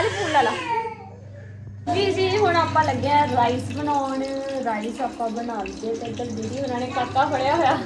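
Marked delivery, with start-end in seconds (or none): none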